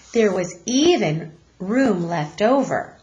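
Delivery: usual speaking voice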